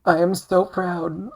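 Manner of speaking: high, slow, gushing over-the-top pride; breathy mock-sentimental pride, drawn out; mock patriotic swoon. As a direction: swoon dramatically over your homeland.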